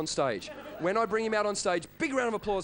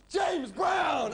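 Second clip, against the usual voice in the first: mock crowd shouting back the name; crowd yell; 9 semitones higher than usual. Crowd answering [name], mock shout. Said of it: "the people just go".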